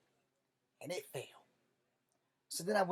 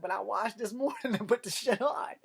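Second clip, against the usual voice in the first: laughing through a louder, higher protest; 6 semitones higher, 6 dB louder than usual. Retold or imitated. Soldier insisting he washed, laughing protest. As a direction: defend yourself while cracking up.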